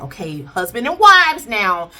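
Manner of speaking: ordinary talk, nothing marked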